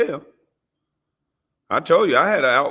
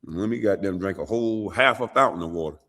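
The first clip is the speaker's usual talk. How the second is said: mock pleading; 6 semitones lower than usual. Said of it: "retold plea to judge".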